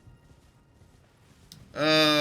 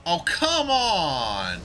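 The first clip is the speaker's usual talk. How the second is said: loud, drawn-out exasperated cry; frustrated shout; 8 semitones higher, 6 dB louder, much slower than usual. Real, not acted. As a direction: groan it out in protest. Frustrated at the game.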